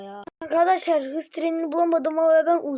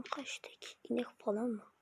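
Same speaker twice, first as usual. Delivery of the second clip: breathy whispered question; 2 semitones lower, 17 dB quieter than usual. Real, not acted. Peering at a shape in the dark VR forest, whispering. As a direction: whisper it so the thing won't hear.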